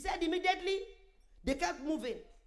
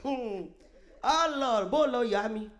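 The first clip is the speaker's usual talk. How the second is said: loud laughing exclamation; real laughter; 5 dB louder, much slower than usual. Real, not acted. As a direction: exclaim slowly and loudly through laughter. Laughing at nurses fleeing in story.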